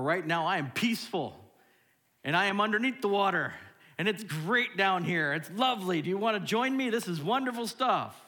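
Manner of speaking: high, loud, swooping delight; hammed-up bliss. Congregant underwater inviting others to join, hammed-up bliss. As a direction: gush as if inviting everyone in.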